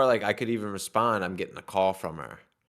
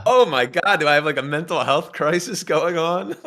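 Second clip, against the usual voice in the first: mock panic; 7 semitones higher, 8 dB louder than usual. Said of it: "and I'm like".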